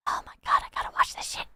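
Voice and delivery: hushed excited whisper. Real, not acted. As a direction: whisper it to yourself. Excited, muttering to self.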